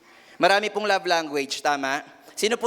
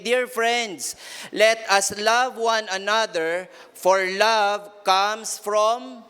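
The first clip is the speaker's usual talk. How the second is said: slow, weighty Bible reading; solemn scripture reading; much slower than usual. Acted. Preacher reading First John to the congregation, slow and solemn. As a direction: linger on each word of the verse.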